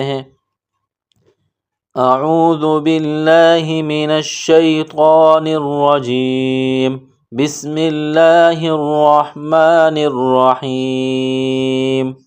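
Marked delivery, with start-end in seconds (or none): 1.9-12.1 slow, drawn-out tajwid chant; Quranic recitation. Shown as a demonstration. Teacher opening the lesson with a recitation.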